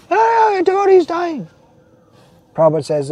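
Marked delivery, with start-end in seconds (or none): 0.1-1.5 much higher mocking voice of a doubter; mock objector. Preacher mimicking a skeptic's claim, much higher mocking. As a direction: parody the skeptic's complaint. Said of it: "if somebody argues".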